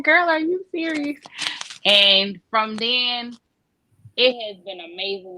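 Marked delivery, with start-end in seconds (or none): 0.0-1.2 put-on high, incredulous girl, are you serious; light mock shock at good news; half-hearted playful disbelief; retold disbelief. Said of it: "I'm like".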